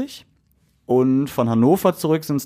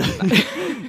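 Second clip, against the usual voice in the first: high laugh; 11 semitones higher than usual. Real, not acted. Laughing at colleague's joke.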